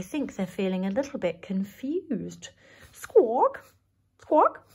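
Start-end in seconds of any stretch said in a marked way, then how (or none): none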